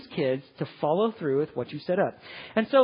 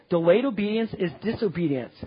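usual speaking voice

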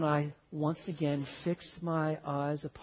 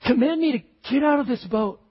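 loud, high call across the water; Peter calling out; 7 semitones higher, 9 dB louder than usual. Acted. Peter calling to Jesus over the storm, loud and high. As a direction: shout out to the Lord from the boat. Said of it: "He says".

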